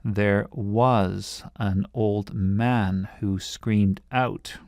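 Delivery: slow, each stress marked clearly; scanning the stresses. Shown as a demonstration. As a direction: read it slowly, beat by beat. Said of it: "you can hear it here".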